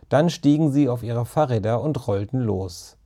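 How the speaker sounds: ordinary delivery, nothing marked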